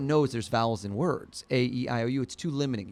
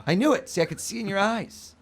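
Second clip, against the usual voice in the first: triumphant excitement; 7 semitones higher, 4 dB louder than usual. Real, not acted. Mind reader triumphant after guess.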